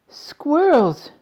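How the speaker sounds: high, loud squeal; excited shout. A narrator's reading. Veruca spots the squirrels, shouting excitedly. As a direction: shriek it with delight. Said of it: "shouted Veruca Salt".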